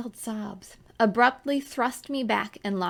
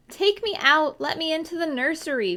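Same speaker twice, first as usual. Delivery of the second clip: frightened cry; 8 semitones higher, 3 dB louder than usual. A narrator's reading. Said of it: "was my cry".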